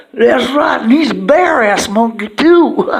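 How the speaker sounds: high, loud squeaky taunt; Riss jeering. Acted. Riss mocking the monkey, high and loud. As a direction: join in the mockery like a cartoon sidekick. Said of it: "Riss is like".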